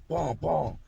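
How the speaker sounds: two high popping gunshot noises; imitated gunfire. Storyteller imitating shooting back while running, high mock gunshots. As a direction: mimic return fire in quick bursts of voice.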